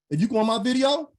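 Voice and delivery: ordinary delivery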